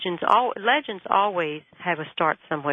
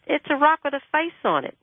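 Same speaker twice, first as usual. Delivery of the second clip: higher, impatient repeat of the same description; exasperated repeat; 8 semitones higher than usual. Retold or imitated. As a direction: say it again like he should have got it.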